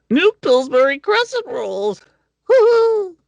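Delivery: very high, loud, cheery ad voice; Pillsbury Doughboy voice. Retold or imitated. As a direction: squeal it like the Doughboy. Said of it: "like the Pillsbury Doughboy".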